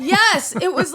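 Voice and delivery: high, loud burst of agreement; emphatic confirmation. Real, not acted. Waitress confirming her strange story to co-host, emphatic. Excited confirmation of co-host's disbelief.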